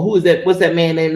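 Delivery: normal talking voice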